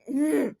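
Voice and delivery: short, annoyed closed-mouth groan; glaring grunt. Retold or imitated. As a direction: grumble wordlessly in mock irritation. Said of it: "I just glared at him".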